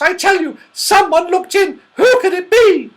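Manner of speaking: very high, loud, urgent voice; alarmed insistence. A narrator's reading. Goldilocks insists someone looked in, alarmed. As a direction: insist in high-pitched panic.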